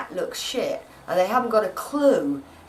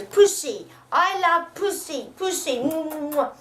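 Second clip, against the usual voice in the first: mimicking besotted man; 8 semitones higher than usual. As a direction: imitate a doting cat lover with smacking kisses. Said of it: "he goes".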